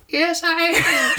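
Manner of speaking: very high, slow witch croon; squeaky, drawn-out storybook witch. Acted. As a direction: draw it out as a hammed-up witch. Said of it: "Trying to do a different voice".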